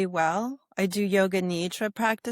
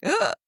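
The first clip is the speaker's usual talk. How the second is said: retold ugh of alarm, higher and a bit louder; a sudden gut-level groan of fear; mock startled groan; 11 semitones higher, 5 dB louder than usual. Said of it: "my nervous system was like".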